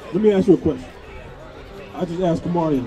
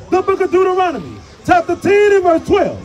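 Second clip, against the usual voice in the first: booming scripture proclamation; shouted scripture reading; 8 semitones higher, 5 dB louder than usual. Acted. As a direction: proclaim the verse to the whole street. Scripture read aloud to crowd.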